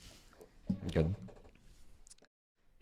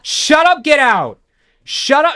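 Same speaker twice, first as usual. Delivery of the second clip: loud, high-pitched yell; 12 dB louder than usual. Acted. Drunk party girl clearing a couple out of the coat room, yelling. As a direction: yell it over and over, full force. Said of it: "And she just yells".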